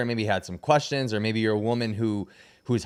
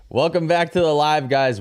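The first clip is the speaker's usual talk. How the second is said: show host intro; 5 semitones higher, 6 dB louder than usual. Acted. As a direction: open the show like an announcer. Stream host restarting the show.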